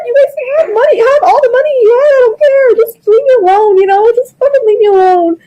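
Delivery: high, strained grieving voice; pleading through tears; tearful. Real, not acted. To Bryce about losing her husband, tearful and pleading.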